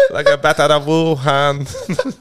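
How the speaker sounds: slow, laughing mock accent; laughing through it. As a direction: crack up mid-line. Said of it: "In a Chinese accent".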